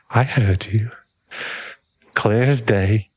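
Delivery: ordinary delivery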